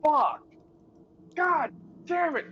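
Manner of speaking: frustrated outburst. Real, not acted. Frustrated reaction to lockdown.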